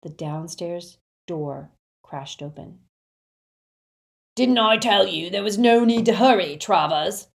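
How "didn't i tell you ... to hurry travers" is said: loud, raised voice of a rough bully; rough, hectoring Death Eater. A narrator's reading. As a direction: throw it out loudly and roughly. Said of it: "said a rough voice".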